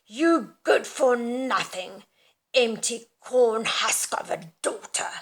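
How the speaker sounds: loud, slow, spitting fury; spat out furiously. Acted. Mother berating her sleeping daughter, spitting fury. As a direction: spit each word at the daughter. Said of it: "spit the words out there because she's furious".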